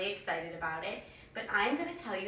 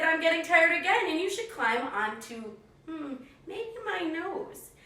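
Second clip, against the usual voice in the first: sly fox; 7 semitones higher than usual. Acted. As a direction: pause slyly before the trick. Fox tricks cookie.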